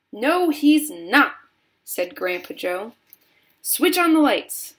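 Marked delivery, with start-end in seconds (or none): 0.1-1.4 slow, high, emphatic denial; firm contradiction. A narrator's reading. Grandpa Joe defends Wonka to the parents, insistent. 3.6-4.7 high, loud command; shout. A narrator's reading. Wonka orders the tunnel lit, shouting. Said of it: "shouted Mister Wonka".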